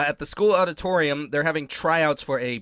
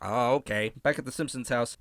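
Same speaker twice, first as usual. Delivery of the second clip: normal talking voice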